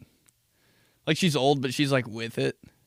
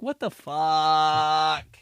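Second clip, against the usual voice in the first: stunned disbelief; 4 semitones higher, 3 dB louder, much slower than usual. Retold or imitated. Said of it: "I'm like".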